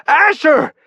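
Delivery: very high, loud call. Acted. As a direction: make him turn around. Calling him from across gap.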